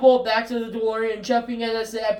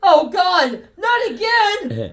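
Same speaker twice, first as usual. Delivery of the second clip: exasperated; 8 semitones higher, 5 dB louder, much slower than usual. Acted. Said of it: "Marty McFly exasperated".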